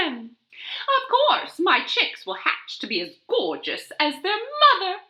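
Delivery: high, smug hen voice. Acted. Dot boasting about future chicks, high and smug. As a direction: say it with a proud little laugh.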